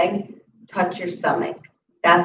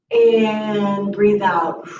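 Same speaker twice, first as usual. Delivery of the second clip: calm slow yoga instruction; slow exhale cue; much slower than usual. Shown as a demonstration. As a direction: stretch the words over a long out-breath. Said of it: "yoga cue paced to exhale".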